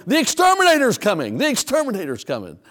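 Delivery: yelled alarm. Acted.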